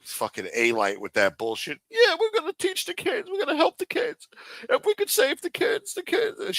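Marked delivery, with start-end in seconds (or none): 1.9-6.6 high, far, squeaky mock voice, much higher; mocking whiny voice. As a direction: parody him in a silly falsetto. Mocking A-Light's kids talk.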